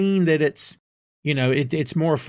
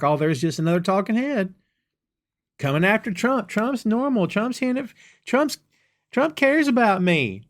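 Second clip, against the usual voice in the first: higher, lightly voiced mock Trump fan; mocking Trump supporter; 6 semitones higher than usual. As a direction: parody a supporter brushing off criticism, climbing higher. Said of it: "you're like".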